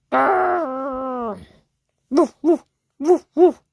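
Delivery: loud barky woofs after a growl; dog growling and barking. Acted. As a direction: bark like an angry dog. Dog growls and barks at cat.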